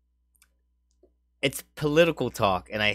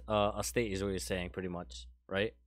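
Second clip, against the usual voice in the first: trailing off; 5 semitones lower, 9 dB quieter than usual. Real, not acted. Question fading into mumble.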